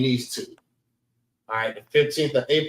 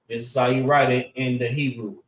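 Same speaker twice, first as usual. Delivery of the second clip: normal talking voice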